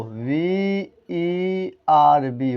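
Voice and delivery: slow, letter-by-letter spelling of وی E R بی. Shown as a demonstration. Teacher spells V E R B slowly for students. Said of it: "spells verb letter by letter".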